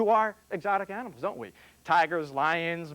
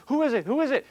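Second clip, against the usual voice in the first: frantic; 8 semitones higher, 4 dB louder, faster than usual. Acted. Hanging man hears a voice.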